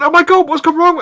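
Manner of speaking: high, loud alarmed cry, hammed up; mock panic. Retelling his reply to the hospital news, high loud mock panic. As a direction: play the friend who panics at once. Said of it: "I said".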